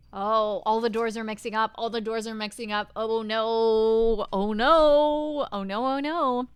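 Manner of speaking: loud, rising alarm; panicked. Acted. Heroine as the doors mix up, loud panic. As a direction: blurt it out in growing panic.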